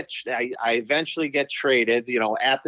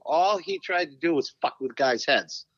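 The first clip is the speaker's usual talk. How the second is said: usual speaking voice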